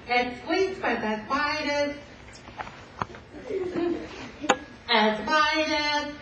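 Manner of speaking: high, slow, drawn-out plaintive voice; tearful young lady. Acted. Bo Peep describing her lost sheep to the detective, high and slow. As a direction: stretch it out, near tears.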